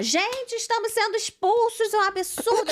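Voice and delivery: a hammed-up public outcry, getting everyone's attention; clapping protest. Telling the diners they are being thrown out, high mock outcry. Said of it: "comecei a bater palma".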